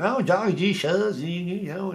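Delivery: high-pitched comic speaking-voice imitation. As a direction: mimic him with a high, silly voice. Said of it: "[name] was like that".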